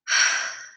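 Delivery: long, audible put-upon sigh; irritated sigh. Shown as a demonstration. Sister asked by brother for her car, irritated sigh. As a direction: let out a reluctant sigh before answering. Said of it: "I reply with a sigh".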